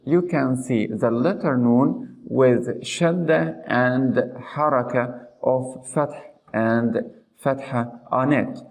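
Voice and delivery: ordinary delivery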